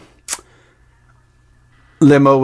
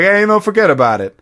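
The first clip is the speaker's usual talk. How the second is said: higher-pitched New York driver; New York accent; 7 semitones higher than usual. Retold or imitated. Limo driver brushing off the story, New York accent. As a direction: toss it off in New York dialect. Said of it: "like, from New York".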